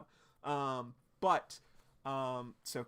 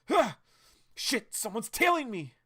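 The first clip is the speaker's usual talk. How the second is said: alarmed; 10 semitones higher, 8 dB louder than usual. Acted. Blyke noticing he is followed.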